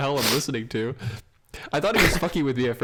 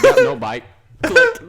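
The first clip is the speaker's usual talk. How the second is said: high, loud, laughing "bye"; laughing hang-up; 12 dB louder, slower than usual. Retold or imitated. Hanging up on the call, high and loud while laughing. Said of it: "I was like".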